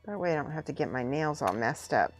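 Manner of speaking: ordinary delivery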